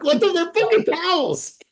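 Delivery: high-pitched mock rant of an angry father; retold angry yelling. Son imitating angry Sicilian father over folded towels, retold angry yelling. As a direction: yell it like a parent outraged over housework. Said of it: "I'd get yelled at if the towels".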